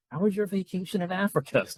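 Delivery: high, airy mock-chirpy asker; silly clueless question. Mocking a clueless friend greeting a returning missionary, chirpy. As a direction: play the oblivious friend. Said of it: "Say silly things like".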